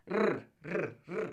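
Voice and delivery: "R" as an isolated tongue trill; rolled R. Shown as a demonstration. Teacher demonstrates the hardest sound, rolled R. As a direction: roll "R" as rrr. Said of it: "You really gotta roll those R's".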